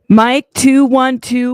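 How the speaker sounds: loud, projected mic test; sudden loud soundcheck call; mic check. Real, not acted. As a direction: project into the mic, counting loudly. Testing the mic for the chat.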